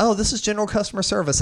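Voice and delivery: high, fast offhand service voice; breezy agent. Retold or imitated. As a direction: toss it off like a script. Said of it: "And they're like".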